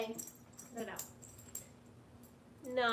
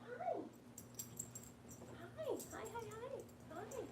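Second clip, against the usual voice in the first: high pet voice; 9 semitones higher, 13 dB quieter than usual. Real, not acted. Greeting pets in high voice.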